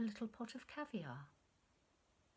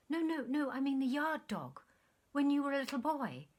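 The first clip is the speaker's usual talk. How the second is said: higher, louder eager correction; delighted insistence; 6 semitones higher, 7 dB louder than usual. Acted. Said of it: "she cried delightedly".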